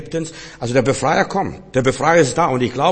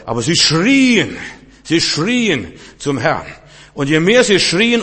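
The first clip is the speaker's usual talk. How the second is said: slow, louder, higher preaching cry; 4 semitones higher, 4 dB louder, slower than usual. Acted. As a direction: let the cry of the people ring out. Preaching Israelites crying to Lord.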